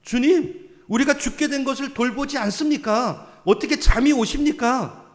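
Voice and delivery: preacher acting the frightened disciples' complaint. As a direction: cry out as if the boat is sinking. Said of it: "막 원망 불평을 하잖아요".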